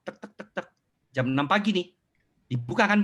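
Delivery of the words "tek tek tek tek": soft unvoiced tek tek knocks; whispered knock-knock noise; knocking sound. Shown as a demonstration. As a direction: tap out a door knock with the tongue. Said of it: "saya ketok".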